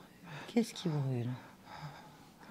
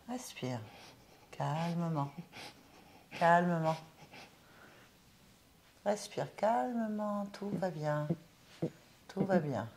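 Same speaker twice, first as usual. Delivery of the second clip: soothing hypnotic guide; 3 semitones lower, 8 dB quieter than usual. Acted. Medium calms the spirit softly.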